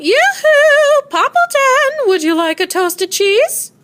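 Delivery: high sing-song yoo-hoo call. A narrator's reading. Neighbor calling over the fence in a high sing-song. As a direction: call across to the neighbor brightly. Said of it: "she called out".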